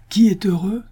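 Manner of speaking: loud, high cry; sudden cry. A narrator's reading. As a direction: burst out with a sudden resolve. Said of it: "s'écria alors Absalom". (exclamation soudaine et aiguë)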